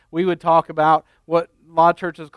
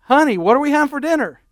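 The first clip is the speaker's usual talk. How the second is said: calling out; 8 semitones higher, faster than usual. Retold or imitated. Husband calls closer, again.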